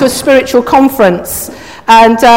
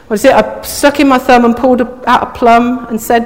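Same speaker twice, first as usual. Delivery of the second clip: usual speaking voice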